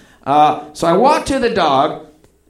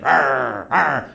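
cornered dog growling; mock animal snarl; imitated dog growl; 4 semitones lower than usual. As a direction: growl twice like a wary dog.

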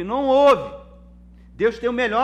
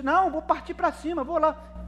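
much higher, eager mock bravado; impulsive rush; 10 semitones higher than usual. Proud listener rushing ahead without God, mock eager. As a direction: play the hothead charging off without asking.

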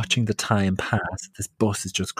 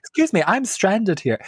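indignant complaint; 11 semitones higher than usual. Retold or imitated. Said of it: "And I said".

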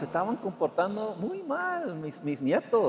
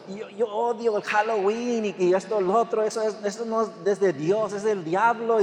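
put-on child voice; 3 semitones higher than usual. Grandson Lucas quoted, child's earnestness.

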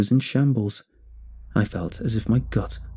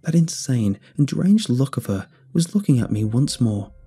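ordinary delivery

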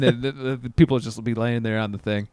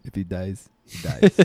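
quoting the director; 5 semitones lower than usual. Retold or imitated. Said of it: "director said".

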